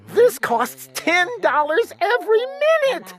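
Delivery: high urgent cry; yelling. A narrator's reading. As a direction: sister yelling about the phone bill. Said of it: "my sister yelled".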